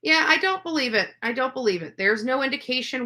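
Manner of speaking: ordinary talk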